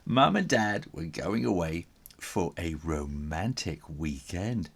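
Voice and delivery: ordinary delivery, nothing marked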